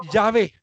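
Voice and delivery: "Yahvé" with no final T, ending on the open é; teacher modelling "Yahvé" without a final T; correct Yahvé. Shown as a demonstration. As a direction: say "Yahvé" cleanly, ja-BÉ, nothing after the vowel. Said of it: "No con T final".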